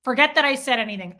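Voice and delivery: withdraw the kindness in annoyance; re-enacted irritated outburst; pissed-off retort. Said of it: "I get so pissed".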